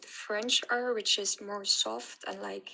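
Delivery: ordinary talk, nothing marked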